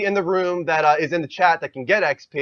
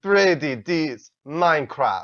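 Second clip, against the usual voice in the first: slow, hammed-up fake Russian accent; mock Russian accent; much slower than usual. Host reads follower name in a goofy Russian accent. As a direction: drag the name out like a cartoon Russian. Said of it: "now you're gonna give me Russian again".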